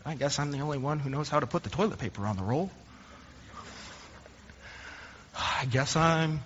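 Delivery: a sighing, put-upon inner voice; grumbling inner thought. Retold or imitated. Pastor voicing his petty thought about toilet paper, muttered and sighing.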